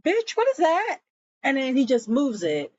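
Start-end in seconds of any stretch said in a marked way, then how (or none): 0.0-1.0 much higher mock disbelief; imitating his baffled outrage; incredulous. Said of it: "he's looking like".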